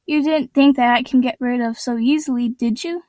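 ordinary delivery, nothing marked

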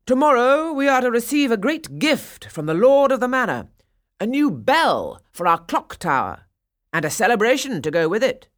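loud grand proclamation; pompous mayor. Acted. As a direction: announce it to the whole square.

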